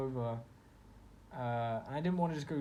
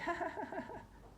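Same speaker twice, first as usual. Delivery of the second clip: put-on girly laugh; 4 dB quieter than usual. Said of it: "And she's like".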